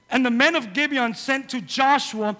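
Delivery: loud, high preaching voice. Acted. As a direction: proclaim it to the congregation. Preacher reading scripture at full force.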